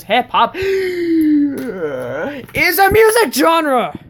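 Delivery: high, drawn-out cry after a big gasp; over-the-top, high-pitched outburst; overwrought outburst. Acted. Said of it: "Are you sure you're all right?".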